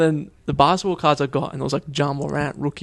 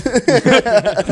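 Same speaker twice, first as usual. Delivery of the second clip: laugh; 8 semitones higher, 5 dB louder than usual. Real, not acted. Laughing at own story.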